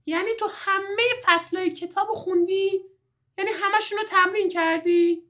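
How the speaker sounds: very high, squeaky astonished questions; amazed. Acted. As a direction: gasp out the questions in disbelief. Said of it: "جواد با تعجب پرسید".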